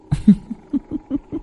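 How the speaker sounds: high, breathy ghostly cackle; soft eerie female cackle; cackling ghost laugh. Acted. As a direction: cackle at him like a vengeful spirit. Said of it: "mà cười khành khạch".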